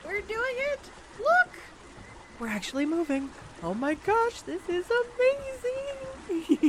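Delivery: real elated cheering. Real, not acted. Pedal boat finally moves.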